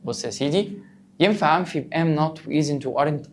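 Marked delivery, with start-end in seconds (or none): none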